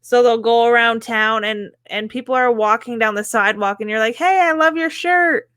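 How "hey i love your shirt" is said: high-pitched, friendly call-out; acted cheery compliment. Driver complimenting someone on the sidewalk, acted cheery and high.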